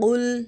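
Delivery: قل with a still, held L at the end; unbounced lam. Shown as a demonstration. Teacher showing students lam sakin, قل ending on a steady L. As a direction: say قل without bouncing the final lam. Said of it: "لام ساکن کو ہم ہلائیں گے نہیں".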